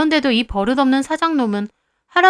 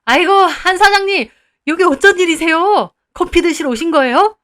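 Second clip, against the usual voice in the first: much higher, louder, fawning; fawning greeting; 5 semitones higher, 6 dB louder than usual. Acted. Owner fawning over the building owner, high and loud. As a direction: grovel sweetly to the big boss. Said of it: "막 웃으면서".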